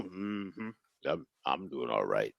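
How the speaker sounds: put-on very low, soft, slow old man; slurring uncle after brown liquor. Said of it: "I call him".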